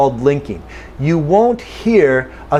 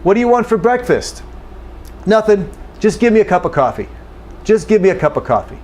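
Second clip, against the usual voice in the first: fast, reduced everyday American dialogue; quick casual exchange at full American speed; normal speed; 5 semitones higher, faster than usual. Shown as a demonstration. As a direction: run it off at natural speed, gimme and cuppa. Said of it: "now I'm going to do it at normal speed".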